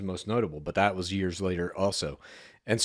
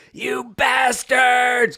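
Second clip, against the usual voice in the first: high, loud, long-drawn yell; full-volume imitation of a furious scream; retold enraged scream; 7 dB louder, much slower than usual. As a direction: re-enact screaming after a truck, stretching each word. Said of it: "I sprinted into the busy street screaming".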